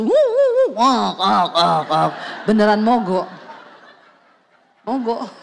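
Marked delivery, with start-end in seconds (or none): 0.0-0.7 high-pitched "wuh" engine whoops; imitated revving engine. Wife mimics the old car climbing a hill, imitated engine noise. 0.8-2.1 sputtering "wak" bursts, dropping in pitch; imitated stalling engine. Wife shows the car breaking down uphill, imitated sputtering.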